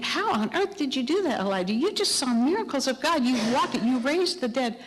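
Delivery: high, incredulous questioning. Retold or imitated. We ask Elijah how he could flee, incredulous. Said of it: "And we think".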